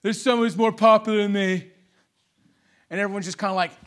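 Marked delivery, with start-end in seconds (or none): 0.0-1.7 louder, lightly voiced Trump boast; hint of a Trump rally voice; mock Trump. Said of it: "He goes".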